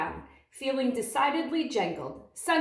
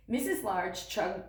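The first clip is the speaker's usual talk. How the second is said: plain reading voice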